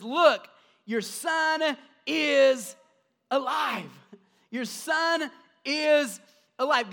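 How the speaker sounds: drawn-out triumphant shout; Elijah's joyful proclamation. Acted. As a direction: proclaim it like a prophet holding up the living child. Elijah presents revived son.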